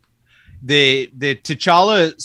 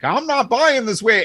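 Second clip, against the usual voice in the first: imitating a friend's high-pitched protest; lightly put-on higher voice, digging in; mock refusal; 8 semitones higher than usual. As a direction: playfully mimic a stubborn refusal. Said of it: "he's like".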